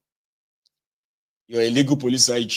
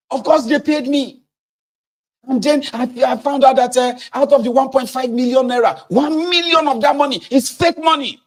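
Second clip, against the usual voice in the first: much higher, loud and flustered rant; angry trader; 13 semitones higher, 4 dB louder than usual. Acted. A cheated trader yelling at the interviewer, furious. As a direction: sputter it out in fury. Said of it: "I said".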